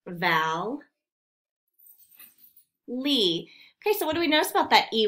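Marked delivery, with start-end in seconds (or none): 0.1-3.5 Val and Lee said apart with a pause between; split into syllables. Shown as a demonstration. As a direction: cut valley into Val then Lee. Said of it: "it's the valley".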